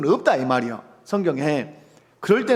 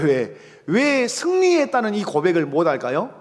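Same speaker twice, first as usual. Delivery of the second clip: normal talking voice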